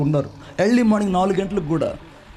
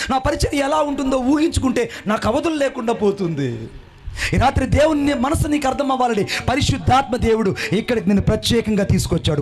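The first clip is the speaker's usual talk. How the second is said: normal talking voice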